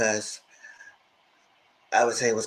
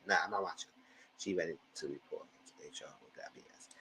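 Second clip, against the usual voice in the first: unvoiced whispered aside; 6 semitones lower, 16 dB quieter than usual. Real, not acted. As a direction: whisper it like a secret.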